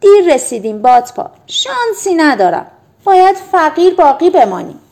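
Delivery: high, loud frustrated cry; disappointed angry shout. A narrator's reading. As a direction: yell it out, fed up and let down. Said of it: "تیم ناامید و عصبانی فریاد زد".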